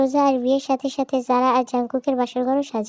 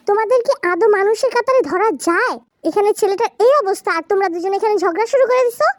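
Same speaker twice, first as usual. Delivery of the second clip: indignant, higher-pitched telling-off; scolding grandparent; 6 semitones higher, 4 dB louder than usual. Acted. Grandparent scolding two women for quarrelling, indignant and high. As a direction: reprimand them with exasperation.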